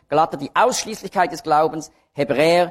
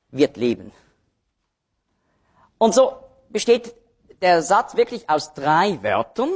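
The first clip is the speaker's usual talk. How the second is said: normal talking voice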